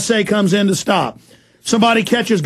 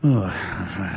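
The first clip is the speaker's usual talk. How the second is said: low quiet contempt; 12 semitones lower, 8 dB quieter, slower than usual. Real, not acted. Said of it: "I get a little fired up".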